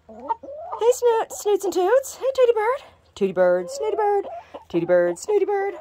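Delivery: very high cooing names, slow; sing-song pet names. Real, not acted. Woman crooning pet names to her two hens, very high sing-song.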